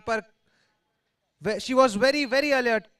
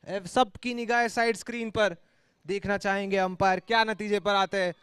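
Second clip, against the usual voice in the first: usual speaking voice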